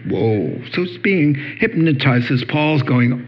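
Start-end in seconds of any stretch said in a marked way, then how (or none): none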